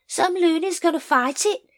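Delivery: high, odd little man voice; Nobby's voice. A narrator's reading. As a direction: play a scruffy corporal.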